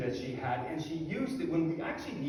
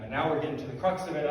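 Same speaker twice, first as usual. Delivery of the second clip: ordinary talk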